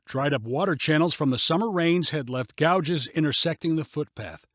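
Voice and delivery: plain reading voice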